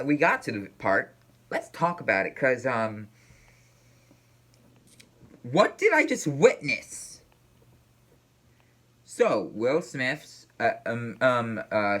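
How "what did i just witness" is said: slow, high, disbelieving question; stunned disbelief. Real, not acted. Reacting to the Oscars slap, stunned and slow. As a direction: let the shock drag every word out.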